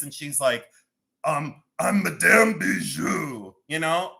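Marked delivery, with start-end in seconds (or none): 1.2-3.5 mock French accent. Host playing a French lady in a dark alley, hammed-up French accent. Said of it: "I don't know the French, uh, accent".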